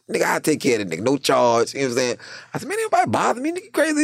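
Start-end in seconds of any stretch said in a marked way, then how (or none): 2.7-4.0 squeaky high retort; high-pitched reply. Retold or imitated. Answering the teasing inmate in a high voice. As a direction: toss it back up high.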